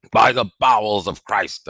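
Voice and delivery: startled loud oath; exclaiming. A narrator's reading. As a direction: blurt out the oath in shock. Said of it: "I heard him exclaim".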